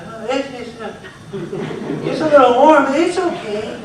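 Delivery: uneasy frog voice. Retold or imitated. Speaker voicing frog in warming water, nervous. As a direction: play a frog pretending all is fine. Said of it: "the frog just kinda goes".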